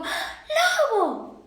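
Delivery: gasp, then a high startled cry; hammed-up fairytale fright. Caperucita spotting the wolf, acted startled gasp and cry. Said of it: "Caperucita dijo".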